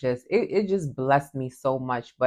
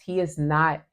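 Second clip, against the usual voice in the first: ordinary delivery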